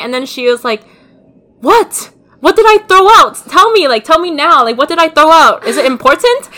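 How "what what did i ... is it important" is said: higher, louder mock-worried mom; alarmed mom. Mom pretending she threw out nothing, in mock alarm. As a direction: play the mom fussing in alarm.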